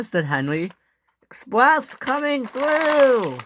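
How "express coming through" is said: high, slow, stretched call; drawn-out high shout. Acted. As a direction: announce it proudly down the line. Henry announcing his train.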